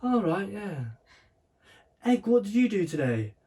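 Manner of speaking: high-pitched mock mum quizzing Egg; imitating mum. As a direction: ham up the mum asking about school. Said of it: "[name] does the best impression of me".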